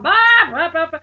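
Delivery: loud horn honks, bah bah; imitated car horn. Imitating the friend's car horn over the phone, loud honks. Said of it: "So I could hear the horn".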